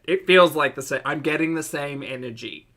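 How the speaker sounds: usual speaking voice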